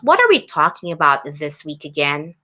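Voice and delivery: usual speaking voice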